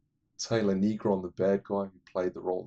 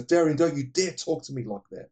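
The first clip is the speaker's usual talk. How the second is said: stern fatherly telling-off; 7 semitones higher, 6 dB louder than usual. Retold or imitated. Father scolding daughter in retold scene.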